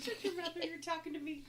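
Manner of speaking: high, loud comeback through laughter; laughing retort. Real, not acted. Sister bantering back at the others, laughing retort. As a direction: snap back playfully while laughing.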